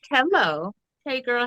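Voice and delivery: normal talking voice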